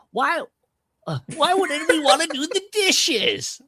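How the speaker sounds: high, loud Vince Vaughn line; Vince Vaughn impression. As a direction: pitch it way up like Vince Vaughn complaining. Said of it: "maybe I can just nail that one word".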